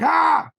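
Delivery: loud high re-enacted scream. Telling how a jump scare got him, re-enacted scream. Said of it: "I screamed out loud".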